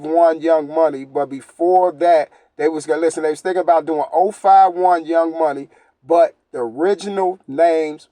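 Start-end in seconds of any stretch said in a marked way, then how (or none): none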